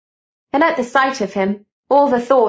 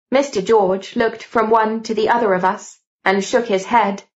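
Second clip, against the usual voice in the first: plain reading voice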